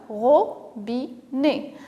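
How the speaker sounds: "Robinet" slowly split into ro, bi, net; syllable by syllable. Shown as a demonstration. Teacher showing children three syllables, "Robinet" said syllable by syllable. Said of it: "se compose de trois syllabes".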